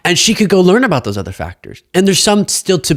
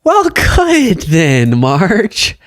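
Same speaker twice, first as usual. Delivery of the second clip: sarcastic; 5 semitones higher, much slower than usual. Real, not acted. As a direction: drag out good then, mocking her. Mocking reply to the speech.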